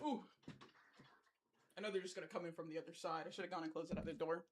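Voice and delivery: talking while walking off to shut a door; closing door mid sentence. Real, not acted. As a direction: voice fading away from the mic. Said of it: "let me close this door".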